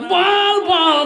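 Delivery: high, loud, insistent cry; acted urging. Mother urges weeping son to speak, loud insistence.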